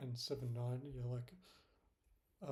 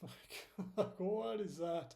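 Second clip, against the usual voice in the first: higher, drawn-out incredulous laughing question; laughing disbelief; 5 semitones higher, slower than usual. Real, not acted. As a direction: laugh in disbelief as you ask. Scoffing at the dead price action.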